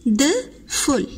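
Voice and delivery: "Wonderful" very slowly as won, der, ful; syllable by syllable. Shown as a demonstration. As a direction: pause between the three syllables of "Wonderful". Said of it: "Three syllables".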